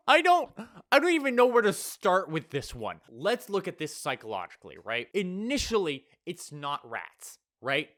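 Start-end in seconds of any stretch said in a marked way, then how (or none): 0.1-3.0 high and loud, lost for words; real speechless shock; flabbergasted. Real, not acted. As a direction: sputter in amazement. Overwhelmed reaction.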